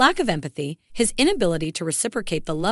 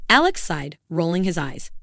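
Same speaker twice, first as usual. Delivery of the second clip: plain reading voice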